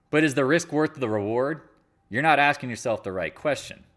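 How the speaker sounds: ordinary talk, nothing marked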